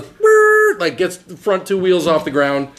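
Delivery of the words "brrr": engine rev. Retold or imitated. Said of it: "their fucking golf cart goes".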